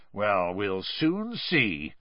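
slow, muted, menacing growl. A narrator's reading. Unimpressed official to the youngsters, growling. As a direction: growl it as a sceptical threat. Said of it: "growled Mister Diggory".

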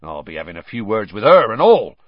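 grim, loud resolve; grim, forceful grumble; Hagrid. A narrator's reading. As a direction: say it grimly, as a threat. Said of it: "said Hagrid grimly".

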